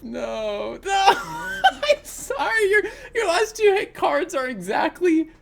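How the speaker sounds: talking through loud, high-pitched laughter; real helpless laughing. Real, not acted. Player to opponent with exactly the right cards, laughing helplessly. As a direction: crack up mid-sentence, voice leaping up. Helpless reaction to demoralizing matchup.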